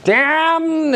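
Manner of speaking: Damned, high and stretched as dam-ned; luxuriating damned. Shown as a demonstration. Teacher showing students how to luxuriate in Damned. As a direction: lean into Damned, lingering. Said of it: "it makes You luxuriate in it".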